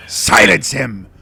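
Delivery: loud, drawn-out monster command; imperious monstrous bellow; commanding creature shout. Acted. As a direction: roar the order as an enraged creature. Creature orders spiders to gag player.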